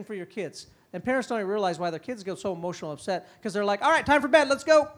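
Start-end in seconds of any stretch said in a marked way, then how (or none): 3.8-4.9 loud, higher snap to bedtime; abrupt order. Retold or imitated. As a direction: cut the fun off abruptly. Parent abruptly ending play.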